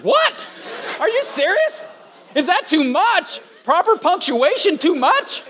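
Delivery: high-pitched, aghast disbelief; incredulous. Retold or imitated. Pastor retelling his shock at texting rules, incredulous. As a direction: act out baffled outrage at the very idea.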